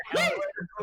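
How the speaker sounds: high, loud hyped cry; hyped exclamation. Retold or imitated. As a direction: shout it out like a eureka. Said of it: "We're like".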